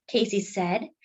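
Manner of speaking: plain reading voice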